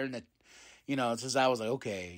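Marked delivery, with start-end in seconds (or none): 1.7-2.2 soft, deflated little okay; lightly imitated dejected wrestler; mock sad. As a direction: sulk it out quietly like a hurt kid. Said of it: "sad and everything like that".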